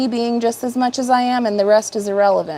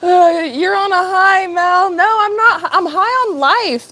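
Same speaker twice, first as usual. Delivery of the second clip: high-pitched, recovering from a laughing fit; real leftover giggles lifting the voice; giggly high voice; 8 semitones higher than usual. Real, not acted. Streamer answering a viewer's "on a high", high giggly voice.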